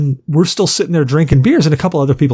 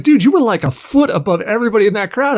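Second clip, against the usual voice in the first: much higher, eager dude; singer's voice; 8 semitones higher than usual. Retold or imitated. Singer tells fan he was front and center, higher hyped voice. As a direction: hype the fan up. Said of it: "He's like".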